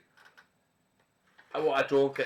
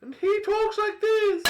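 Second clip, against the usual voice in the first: much higher and louder, slow and drawn out; Tinny's voice; 8 dB louder, slower than usual. Pretend. Streamer voicing his glass named Tinny, much higher, slow. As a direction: put on a tiny cartoon character voice for the glass. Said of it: "This is Tinny".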